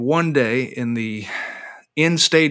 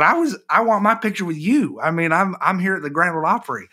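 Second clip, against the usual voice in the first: much higher, excited protest; giddy disbelief; 9 semitones higher than usual. Retold or imitated. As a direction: gush like a starstruck fan. Retold amazed reply to guard.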